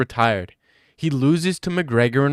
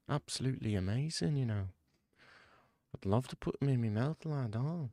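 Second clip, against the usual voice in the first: much softer, hushed English lad voice; Tom Aspinall whisper impression; 8 dB quieter than usual. Joking impression of the fighter hyping a mate, hushed. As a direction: croon compliments in a soft lad murmur. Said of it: "It's my impression of Tom Aspinall in a Whisper".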